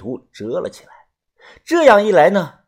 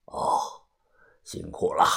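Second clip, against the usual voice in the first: low, soft thanks; tired old boss; 10 semitones lower, 9 dB quieter than usual. A narrator's reading. Boss thanks helper before resting, low soft voice. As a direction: murmur it low and soft, like an elder.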